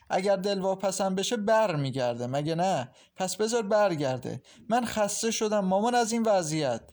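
high, fed-up retort; exasperated protest. A narrator's reading. Daughter tired of the situation answering mother, exasperated. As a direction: push back at her, at wit's end.

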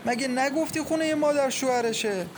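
high, anguished lament; wailing. A narrator's reading. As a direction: cry out in shock and dismay, high-pitched. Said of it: "و با ناله گفت".

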